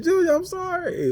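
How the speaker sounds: cracked high falsetto sob; squealing fake sob apology; mock crying. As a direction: wail it like a hammed-up crybaby. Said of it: "He was crying".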